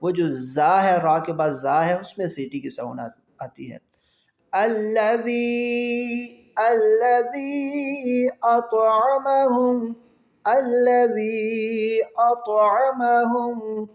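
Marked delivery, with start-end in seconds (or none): 4.5-13.8 slow, drawn-out tajweed chant; تلاوت کا انداز; Quran recitation. Shown as a demonstration. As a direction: stretch the long vowels like a reciter. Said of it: "قلقلہ ہوگا".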